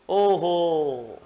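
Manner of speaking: long drawn-out exclamation of wonder; stupore dimostrativo. Shown as a demonstration. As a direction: draw out an astonished oh-ho as an example. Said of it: "अचंबे का".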